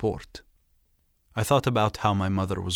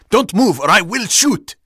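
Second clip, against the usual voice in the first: loud, high shouted command; shouted threat; 11 semitones higher, 8 dB louder than usual. A narrator's reading. Soldier threatens to shoot the captive, shouting. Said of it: "he shouted".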